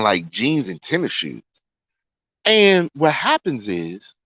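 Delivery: usual speaking voice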